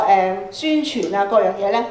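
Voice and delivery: ordinary talk, nothing marked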